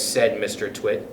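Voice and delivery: usual speaking voice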